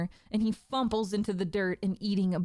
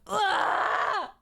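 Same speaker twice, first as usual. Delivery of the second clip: high, loud pained cry; a stabbed man's high whining cry; pained whine; 13 semitones higher, 4 dB louder than usual. Acted. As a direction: yelp as the blade goes in. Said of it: "lets out a whine".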